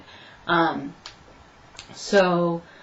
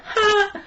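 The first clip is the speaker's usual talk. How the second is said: triumphant ha; 13 semitones higher than usual. Real, not acted. Triumphant laugh in story.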